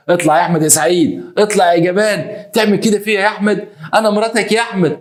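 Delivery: high, aggrieved call to a husband; wronged wife calling out. Retold or imitated. Betrayed wife tells husband she is his wife, high aggrieved cry.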